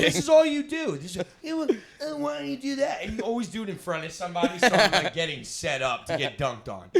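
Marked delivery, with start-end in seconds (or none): 0.0-3.0 high-pitched mocking mimicry; mocking imitation. Mocking a friend who always does this, high mimicry. Mocks friend's habit in quarrel.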